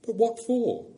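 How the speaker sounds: put-on a high, curious boy's question; puzzled boy. A narrator's reading. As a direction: ask it like a wondering child. Shasta asks.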